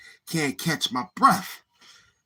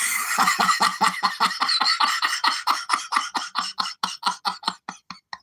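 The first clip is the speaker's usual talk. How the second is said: long breathless wheezing laugh; real laughing fit; 4 semitones higher than usual. Real, not acted. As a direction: burst into helpless, drawn-out laughter. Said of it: "I can't stop laughing".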